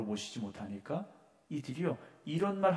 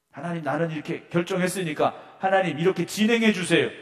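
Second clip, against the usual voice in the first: loud and higher, giving God orders; mock bossy prayer; 5 semitones higher, 9 dB louder than usual. Preacher mocking a believer who hires God, loud and bossy. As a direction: dictate the plan to God, pitched up and loud. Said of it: "이것밖에 안 된다라는 거죠".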